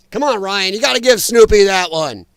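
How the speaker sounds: ordinary delivery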